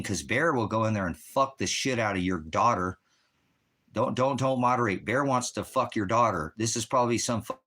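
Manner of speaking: heated, high and forceful; real angry tirade. Real, not acted. Streamer denouncing a commenter to his viewers, angry. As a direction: rail at them, pitch and level up. Livestreamer rants against chat commenter.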